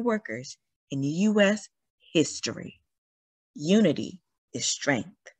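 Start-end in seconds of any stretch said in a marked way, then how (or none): none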